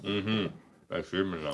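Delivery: talking through a cracker; chewing while answering; mouth full. Real, not acted. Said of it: "my way of letting you know I'm eating a cracker".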